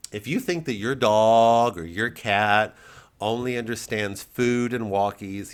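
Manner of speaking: normal talking voice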